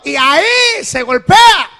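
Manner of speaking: y ahí se golpea shouted high and slow; loud slow preacher's demonstration; slow emphatic shout. Shown as a demonstration. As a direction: hammer out each word like a preacher. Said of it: "Aquí es la parte indicada".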